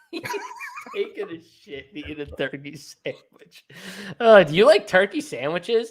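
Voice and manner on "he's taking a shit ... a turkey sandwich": laughing echo. Real, not acted. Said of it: "echoing line, laughing".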